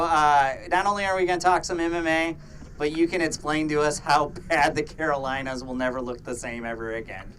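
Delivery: ordinary delivery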